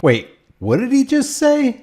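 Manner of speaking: high, incredulous double take; stunned disbelief; surprised. Real, not acted. Reacting to cop's words.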